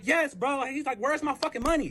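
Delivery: high-pitched, impatient retort; irritated demand. Retold or imitated. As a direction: retell him demanding what he's owed. Retold boyfriend demanding his money.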